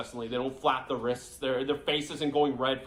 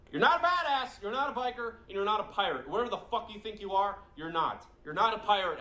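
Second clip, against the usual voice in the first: mocking yell; 7 semitones higher than usual. Real, not acted. Scoffing at a rival youtuber, high mocking yell. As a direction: jeer at him as if he's ridiculous.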